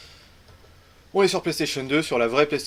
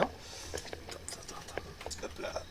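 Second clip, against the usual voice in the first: hushed whisper under the breath; 18 dB quieter, slower than usual. Real, not acted. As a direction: whisper while fiddling with the cable. Fixing the fallen camera.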